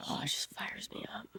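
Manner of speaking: hushed whisper, no voice. Real, not acted. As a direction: breathe it out as a whisper. Whispered aside.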